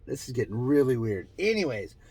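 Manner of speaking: usual speaking voice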